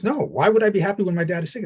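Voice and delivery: indignant retort. Retold or imitated. Client refusing in retold argument.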